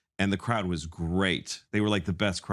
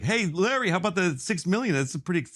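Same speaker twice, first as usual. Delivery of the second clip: sneering golfer heckler; 10 semitones higher than usual. Retold or imitated. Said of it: "The guy's like".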